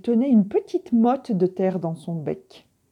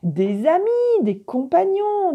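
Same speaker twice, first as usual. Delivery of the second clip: much higher, louder declaration; eager revelation; 10 semitones higher, 3 dB louder than usual. A narrator's reading. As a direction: announce the missing piece with joy. Coyote names what is missing. (Coyote annonce aux canards, enthousiaste)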